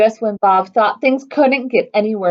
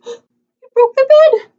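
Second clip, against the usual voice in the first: ordinary delivery, nothing marked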